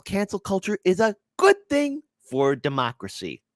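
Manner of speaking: high mock-earnest voice; mocking quote. Mockingly voices article's stance.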